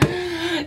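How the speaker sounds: hammed-up sharp gasp; mock-tragic intake of breath; dramatic gasp. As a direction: gasp as if crushed, for show. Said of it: "that was really dramatic".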